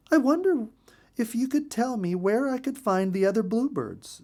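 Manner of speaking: high, light bird voice; a small lost bird asking politely; lost bluebird asking for help; little bluebird. A narrator's reading. Lost Betty asks the chickadee.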